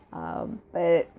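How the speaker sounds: ordinary delivery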